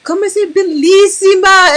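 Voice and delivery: high, gushing Italian compliment. Retold or imitated. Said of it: "They're like".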